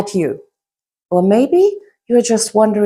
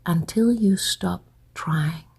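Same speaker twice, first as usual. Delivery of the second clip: soft, lower voice with long pauses between phrases; guided hypnosis; 3 semitones lower, 7 dB quieter than usual. Acted. Hypnotist guiding listeners to let their thoughts drift, soft hypnotic voice. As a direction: lull the listener gently, hushed and unhurried.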